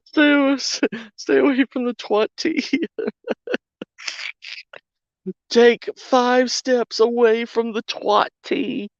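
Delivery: mock high warning voice. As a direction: squeak the warning in a put-on high voice.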